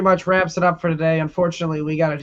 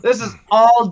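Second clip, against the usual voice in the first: higher, laughing through the words; real laughing; 8 semitones higher, 4 dB louder than usual. Real, not acted. Host amused by listeners' comments, laughing as he talks. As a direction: break into a high laugh mid-sentence. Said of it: "I'm laughing at Tyler's and Alex".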